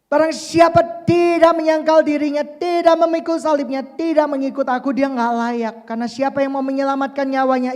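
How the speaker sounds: loud, high impassioned preaching; preaching at full force. Acted. Preacher warning the congregation about losing one's life, forcefully. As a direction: drive the warning home.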